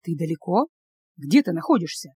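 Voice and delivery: low, soft careful questioning; gentle coaxing. A narrator's reading. Ilya trying to locate distraught Anya, coaxing. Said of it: "уже вкрадчивым голосом".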